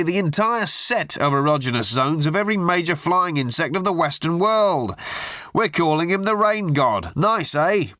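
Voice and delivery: butter him up with absurd pet names; gushing hack journalist. A narrator's reading. Tabloid journalist gushing down the phone at Arthur. Murray's voice again.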